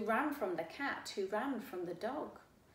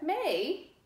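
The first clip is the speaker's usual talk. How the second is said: high, loud indignant me; a startled who-me; surprised frog; 7 semitones higher, 9 dB louder than usual. Acted. Frog answers the boy, startled and high.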